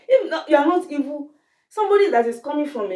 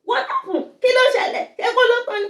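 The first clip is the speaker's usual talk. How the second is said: very high-pitched, hammed-up questioning; mock auntie interrogation; 13 semitones higher, faster than usual. On arriving home to the auntie, high mocking interrogation.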